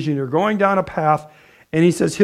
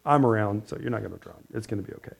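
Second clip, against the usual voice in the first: much softer, lower, calm and reassuring; calm reassuring Jesus; 9 semitones lower, 11 dB quieter, faster than usual. Retold or imitated. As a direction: soothe them gently, no worry at all. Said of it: "Jesus is like".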